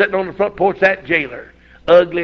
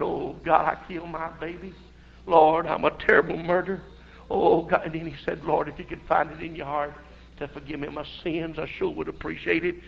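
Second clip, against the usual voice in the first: much softer, hushed weeping prayer; tearful prayer; 2 semitones lower, 12 dB quieter than usual. Retold or imitated. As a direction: murmur it like a broken man praying. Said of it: "that man cried and said".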